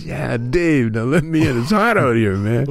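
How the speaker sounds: exasperated. Retold or imitated. Cheech, stuck outside in heat, acted exasperated. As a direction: insist on being let in. Locked-out Cheech pleading, angrier.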